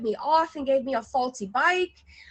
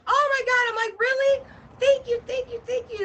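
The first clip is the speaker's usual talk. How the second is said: relieved gushing; 9 semitones higher than usual. Retold or imitated. Grateful relieved outburst.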